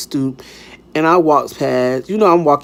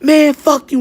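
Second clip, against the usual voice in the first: high, loud mock yell; raised-voice retort, imitating a quarrel; imitated screaming; 12 semitones higher, 5 dB louder than usual. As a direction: act out his angry phone shout. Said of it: "he screaming".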